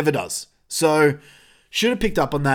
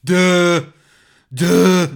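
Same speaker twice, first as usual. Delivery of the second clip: loud, dopey mocking grunt; Cartman; 3 semitones higher, 6 dB louder than usual. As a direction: imitate a cartoon kid playing dumb, loudly. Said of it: "walks around going".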